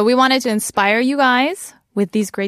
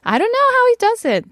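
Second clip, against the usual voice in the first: excited gush; 14 semitones higher, 4 dB louder, faster than usual. Real, not acted.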